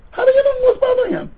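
high, disbelieving challenge; incredulous question. Acted. Friend doubts the student's claim, in a high incredulous voice. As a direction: ask it as if you can't believe it.

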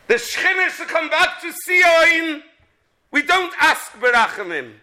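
high, loud rising sermon cry; fiery preaching. Acted. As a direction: preach it out with full fervour. Preaching at full force.

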